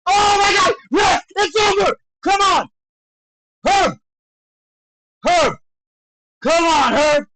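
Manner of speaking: loud, high yells at the referee; urgent excited shouting. Real, not acted. Screaming at referee to stop it.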